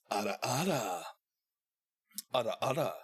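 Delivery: "ara" with a quick tapped R, not rolled. Shown as a demonstration. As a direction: flick the tongue once on the R of "ara". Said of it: "cut short almost".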